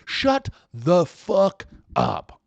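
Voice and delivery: loud, high, drawn-out yell; loud, slow outburst at the neighbor; yelled shut up. Real, not acted. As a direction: shout them down, slow. Yelling at the neighbor.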